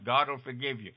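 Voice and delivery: ordinary talk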